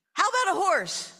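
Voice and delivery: high, loud eager pitch for a horse; excited little girl waking her parents; eager child. Acted. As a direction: burst out with a child's big idea. Child wakes sleeping parents.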